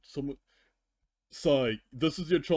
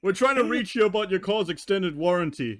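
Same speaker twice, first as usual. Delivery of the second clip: higher, louder sing-song telemarketer parody; a cheesy recorded-sales voice, louder and higher; mock robocall voice; 7 semitones higher, 6 dB louder than usual. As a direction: ham up a scam caller's script. Mocking a spam robocall.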